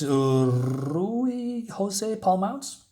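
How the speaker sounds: slow, careful sounding-out of "Rui Jose Palmounts"; careful name pronunciation. Shown as a demonstration. Reviewer unsure of an artist's name, says it slowly and carefully. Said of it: "I believe I said that correctly".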